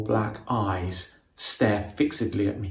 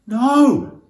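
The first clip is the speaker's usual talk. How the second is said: high, loud anguished scream; desperate cry of horror; 12 semitones higher, 8 dB louder than usual. A narrator's reading. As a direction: scream as he is shot. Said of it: "I scream".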